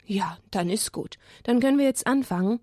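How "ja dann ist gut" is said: soft unvoiced whisper. Real, not acted. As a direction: whisper it aside. Whispered aside.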